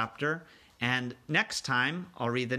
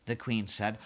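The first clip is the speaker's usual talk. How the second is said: ordinary delivery, nothing marked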